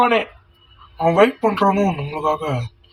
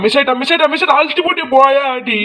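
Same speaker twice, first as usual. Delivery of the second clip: high, frantic repeated cries at the screen; urgent gamer panic; shouting at the game in frustration; excited yelling; 9 semitones higher, faster than usual. Real, not acted. Missed shot in gameplay.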